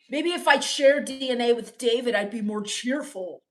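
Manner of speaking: ordinary talk, nothing marked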